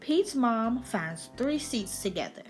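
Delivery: usual speaking voice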